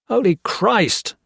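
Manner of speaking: officer storming at Hawkins. A narrator's reading. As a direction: explode at him. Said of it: "Gray stormed at him".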